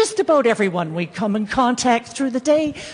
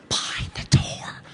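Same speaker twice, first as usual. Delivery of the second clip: soft secret whisper; hushed whisper; 7 dB quieter than usual. Acted. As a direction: drop to a whisper on the door. Dramatic hush.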